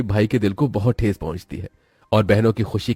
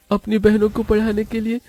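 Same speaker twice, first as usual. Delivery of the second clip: put-on very high, plaintive boy's voice; high-voiced boy resolving to work harder; worried poor boy; 12 semitones higher than usual. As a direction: fret aloud in a high little voice. Poor boy worries about sisters.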